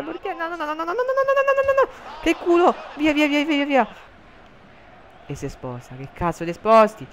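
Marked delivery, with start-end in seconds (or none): 0.0-2.2 rising panicked no, much higher. Real, not acted. Panicking as keeper is beaten. 2.2-3.9 loud relieved shout, much higher; excited shout. Real, not acted. Lucky escape, clearing ball.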